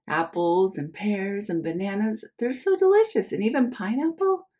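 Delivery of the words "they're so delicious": usual speaking voice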